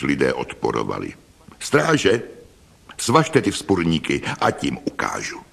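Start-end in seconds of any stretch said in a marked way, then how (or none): none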